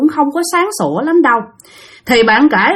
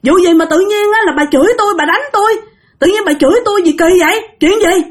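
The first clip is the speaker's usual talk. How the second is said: indignant confusion; 7 semitones higher than usual. Retold or imitated. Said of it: "Các bạn hỏi rằng".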